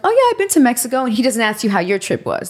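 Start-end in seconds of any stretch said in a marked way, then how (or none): 0.0-1.0 higher-pitched, imitated date chat; mock interested reply. Woman replying to dull date, mock interested voice. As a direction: play the eager listener, pitch up. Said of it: "you're like".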